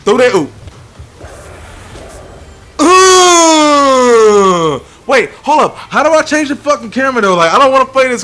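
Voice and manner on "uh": long, loud falling "ooh"; drawn-out whoop. Real, not acted. Player hypes his own play, drawn-out whoop. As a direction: start high and loud, slide slowly down. Cry after a play in game.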